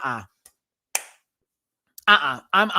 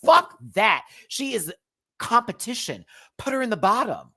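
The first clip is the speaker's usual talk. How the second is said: usual speaking voice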